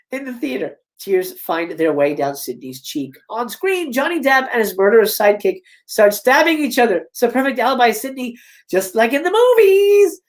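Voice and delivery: usual speaking voice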